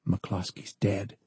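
slow, soft whisper; hushed bad news, slowly. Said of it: "the guard whispered to John".

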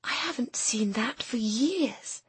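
slow, breathless whisper; awed whisper. A narrator's reading. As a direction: whisper it like a long-lost treasure. Awed at childhood book.

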